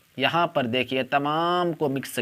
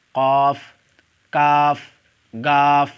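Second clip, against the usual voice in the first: each letter called out loud and separate; alphabet recitation; 5 dB louder, much slower than usual. Shown as a demonstration. Teacher calling out Urdu letters to online students, loud and deliberate. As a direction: drill the letters slowly for the class.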